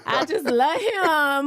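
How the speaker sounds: high, drawn-out mock swoon; imitating lovestruck gushing. Mora sparkling over Rob's promise, mock lovestruck gushing. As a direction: gush like a smitten fan. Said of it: "her eyes are sparkling".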